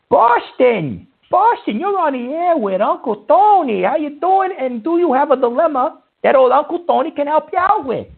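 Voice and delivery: cheesy late-night radio host; radio host intro. Acted. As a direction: welcome the caller on air like a DJ. Radio host greets caller on air.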